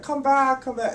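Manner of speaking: loud, high-pitched imitation of a seller calling out; vendor calling back. Market vendor calling after a departing shopper, imitated urgent call. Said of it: "they're like".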